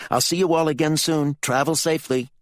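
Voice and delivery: brisk, high-pitched farewell. A narrator's reading. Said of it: "Geneva said briskly".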